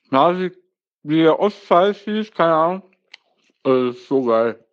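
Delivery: talking through a mouthful of Nutella; words round a spoonful; mouth full. Real, not acted. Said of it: "[name] spricht mit vollem Mund". (mit vollem Mund)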